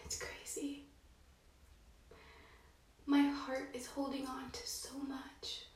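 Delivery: a real, shaky-edged whisper. Real, not acted. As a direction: confide it barely voiced. Emotional confession, whispered.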